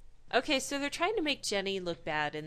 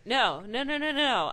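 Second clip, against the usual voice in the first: louder, higher insistent no no no; bossy refusal; 3 semitones higher, 6 dB louder than usual. Pretend. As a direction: wag a finger, no no no. Acting bossy boss refusing.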